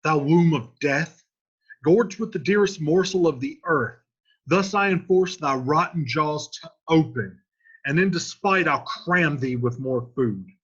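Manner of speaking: loud, declaiming at the tomb; Romeo's loud tomb speech. Acted. Romeo forcing the tomb open, loud declaiming. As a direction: hurl the words at the tomb. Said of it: "He says".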